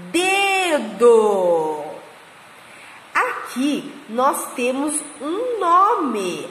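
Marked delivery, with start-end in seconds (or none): 0.1-1.8 "Dedo" drawn out in two long syllables. Shown as a demonstration. Teacher joining syllables for the kindergarten class, drawn out. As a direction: stretch each syllable of "Dedo". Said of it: "a junção dessas sílabas".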